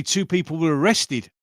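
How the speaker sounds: ordinary talk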